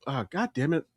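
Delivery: ordinary talk, nothing marked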